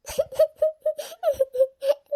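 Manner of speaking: high, choked crying sob. Acted. Lakshmi frightened for her sister-in-law, high choked sob. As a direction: let a long sob break out.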